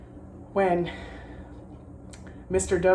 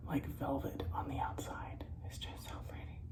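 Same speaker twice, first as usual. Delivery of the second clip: soft admiring whisper; 3 semitones lower, 14 dB quieter than usual. Real, not acted. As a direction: hush your voice in awe.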